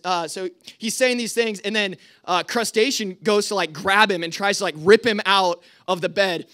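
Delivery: usual speaking voice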